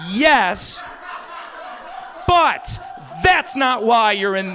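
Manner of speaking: loud, high exasperated dad; retold shouted rebuttal; emphatic admission. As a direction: belt it out with pauses between the concessions. Father answering the screaming son.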